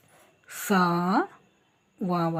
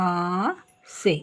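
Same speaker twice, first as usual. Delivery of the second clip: slow reading; 3 dB louder, much slower than usual. Shown as a demonstration. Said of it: "reading word slowly".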